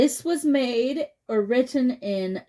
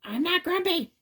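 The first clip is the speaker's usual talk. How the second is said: much higher, put-on grumpy monkey voice; sulky monkey; 9 semitones higher than usual. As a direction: protest like a sulky cartoon chimp. Jim denies grumpiness.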